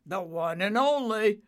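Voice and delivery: loud, proud father-cloud voice; grand confirmation. Acted. Cumulo confirming to Nimbus, grand. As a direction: announce it with weight.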